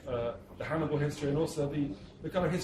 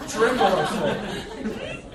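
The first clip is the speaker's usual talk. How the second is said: very slow, louder, higher mock-menacing declaration; theatrical boast; 6 semitones higher, 6 dB louder, much slower than usual. Baron boasting of scaring Dan Brown, slow hammed-up menace. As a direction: ham it up like a stage villain.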